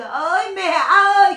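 drawn-out, loud imitation of a wail; mock wailing. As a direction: wail it out slowly to mimic her crying. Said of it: "khiếp nó khóc". (giả giọng khóc than kéo dài)

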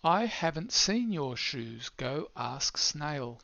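slow whisper, almost no voice; hushed storybook read; whispered character line. Shown as a demonstration. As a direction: breathe the words out slowly like a sleepy snail.

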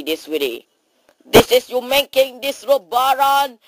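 normal talking voice

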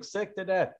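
normal talking voice

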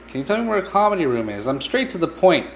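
rushed, clipped delivery; fast-talking hero. Pretend. The comedian asking the barman, talking fast. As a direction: rattle it off quickly. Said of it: "he talks very quickly".

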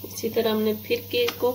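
normal talking voice

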